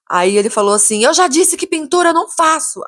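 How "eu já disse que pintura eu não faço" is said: higher-pitched, irritated insistence; acting out an exasperated refusal; retold angry outburst. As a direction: mimic the builder losing his temper. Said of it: "por que que você tá gritando comigo".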